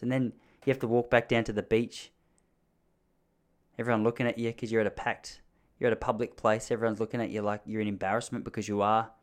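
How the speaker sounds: ordinary delivery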